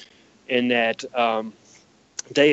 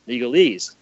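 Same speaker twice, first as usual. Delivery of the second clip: normal talking voice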